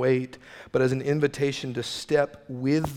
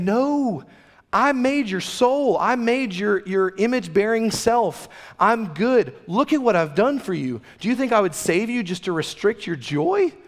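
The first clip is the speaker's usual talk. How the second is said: higher, earnest voice of God speaking to his people; voicing God; 8 semitones higher, 3 dB louder than usual. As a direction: pitch it up and plead like a loving God. Pastor voicing God's reply.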